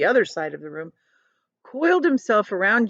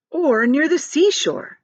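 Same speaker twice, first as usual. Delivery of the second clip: slow, higher, hopeful; eagerly; 8 semitones higher, 4 dB louder, slower than usual. Acted. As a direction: dream out loud about the beach. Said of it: "said James eagerly".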